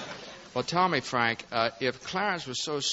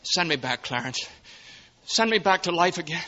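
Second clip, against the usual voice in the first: loud, high, frantic begging; desperate plea; 6 semitones higher, 6 dB louder than usual. Acted. George pleading with Clarence to return, frantic. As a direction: plead as if life depends on it.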